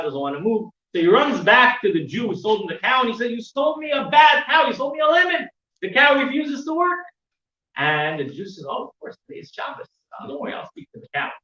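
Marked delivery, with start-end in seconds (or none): 3.3-7.0 raised, higher voice, retelling an annoyed buyer; indignant complaint. Retold or imitated. Neighbor complains about the cow. 8.7-11.3 soft, near-whispered, knowing explanation; hushed reassurance. Retold or imitated. Owner calmly explains it's Shabbos.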